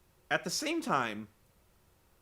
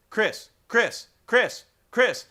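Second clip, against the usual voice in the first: loud insistent calling of a name; 2 semitones higher, 9 dB louder than usual. Retold or imitated. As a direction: keep calling him louder each time. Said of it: "is saying".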